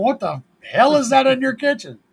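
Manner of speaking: high-pitched mock astonishment; incredulous visitor. Visitors react to the round oven in the kitchen, incredulous. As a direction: act out a baffled, exclaiming onlooker.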